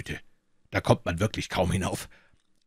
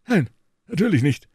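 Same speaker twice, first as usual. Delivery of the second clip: put-on high, brusque old scholar cutting him off; curt professor; 10 semitones higher, 7 dB louder than usual. A narrator's reading. Professor brusquely dismissing Holmes, high and curt. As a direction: snap it off like an offended professor. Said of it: "sagte der Professor schroff".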